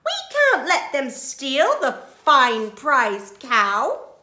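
slow, loud, high outcry; loud protest. A narrator's reading. Farm animals refusing to let thieves steal, loud protest. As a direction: protest loudly, word by word.

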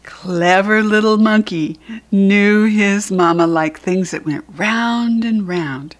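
usual speaking voice

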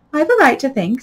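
loud, high, sharp reply; sharp retort. A narrator's reading. Alice worried by the Duchess, answering sharply. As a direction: stand up for yourself sharply. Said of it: "said Alice sharply".

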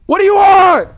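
high, loud frightened yell; scared shout. Real, not acted. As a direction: yell it out in fear. Second, screamed.

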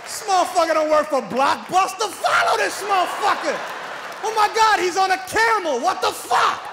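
high, loud shouted pursuit; hammed-up yelling chase. Comic pursuer following the courier, loud high shout. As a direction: yell like you're chasing him down.

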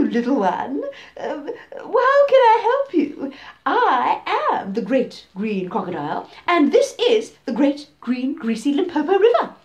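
loud, oily crocodile voice; a sneaky predator faking kindness; sly crocodile. Acted. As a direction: crocodile coaxing the little elephant. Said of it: "And the crocodile said".

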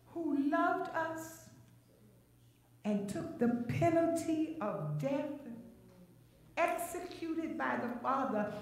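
deliberate preacher's teaching pace; slowed down. Real, not acted. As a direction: spell it out slowly with a pause after each phrase. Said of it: "I'm gonna slow it down".

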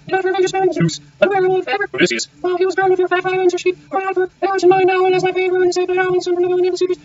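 very high monotone prayer cry; crying aloud. A narrator's reading. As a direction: cry out to the goddess on one unvarying high pitch. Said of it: "She cried aloud as she spoke".

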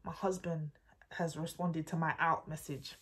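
breathy whispered aside. Real, not acted. Telling viewers the husband replied, whispered. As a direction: drop to a secret whisper.

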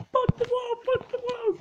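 high-pitched squawk under attack; high panicked shout. Real, not acted. Panicking after failed jump, attacked.

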